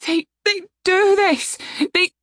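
high, anguished protest; high-pitched, upset cry; distressed outburst. Acted. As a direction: stammer it out in distress. Attacked half-elf explains in distress.

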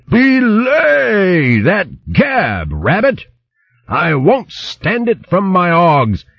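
blustering hedgehog baron in a fury; furious shouting. A narrator's reading. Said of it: "shouting in a voice which caused the leaves".

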